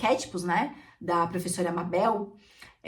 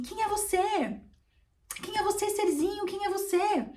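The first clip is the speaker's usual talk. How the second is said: imitating her teacher; 10 semitones higher, 3 dB quieter than usual. Voicing teacher's question to her.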